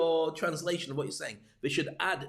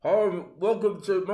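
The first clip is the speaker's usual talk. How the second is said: louder, slow, strained through the mask; muffled through mask; 3 semitones higher, 7 dB louder, slower than usual. Shown as a demonstration. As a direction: talk as if choking behind a mask. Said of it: "looks like I'm trying to strangle myself".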